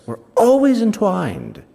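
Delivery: a hint of the old friend confiding by the fire; old friend musing. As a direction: lift the voice a touch for the friend's cherished theory. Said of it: "he says".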